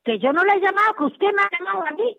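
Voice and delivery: high, insistent protest; insist, losing patience, pitch up; exasperated. Real, not acted. [name] protests to the caller that he phoned her, exasperated.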